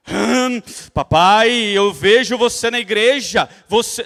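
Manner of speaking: loud, slow, drawn-out affected delivery; put-on church voice. Believer telling dad about church, in a put-on preachy voice. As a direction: swell into an exaggerated evangelizing voice. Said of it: "muda a voz pra falar".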